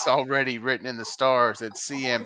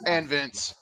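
normal talking voice

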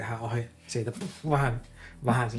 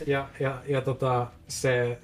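ordinary delivery

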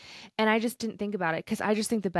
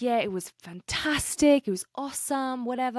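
Englishman's accent put on, a bit higher; imitated British accent; 5 semitones higher than usual. Camper reports back on his night, imitated British accent. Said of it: "he has an accent".

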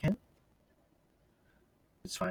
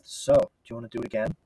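man doing a woman's voice; 5 semitones lower, 3 dB quieter than usual. Acted. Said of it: "I like how your voice changed in there".

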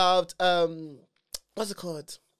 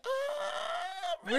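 surprised; 9 dB quieter, much slower than usual. Retold or imitated. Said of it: "I was like".

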